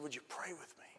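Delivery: whispered, pleading ask; hushed plea. Retold or imitated. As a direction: breathe the request softly. Pastor's plea retold in a hush.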